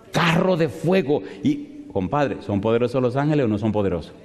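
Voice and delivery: ordinary talk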